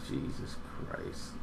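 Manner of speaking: breathy under-the-breath curse; exasperated mutter. Real, not acted. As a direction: mutter it to yourself, annoyed. Can't recall actress's name.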